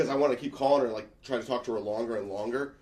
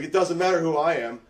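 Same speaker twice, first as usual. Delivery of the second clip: tough warning; 6 semitones higher, 6 dB louder than usual. Retold or imitated. Threatening hockey player.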